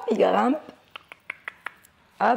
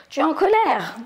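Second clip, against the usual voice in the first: mismatched smiling anger; said with a big smile; 6 semitones higher, 3 dB louder than usual. Shown as a demonstration. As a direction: say angry words while grinning. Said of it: "avec un grand sourire". (avec un grand sourire)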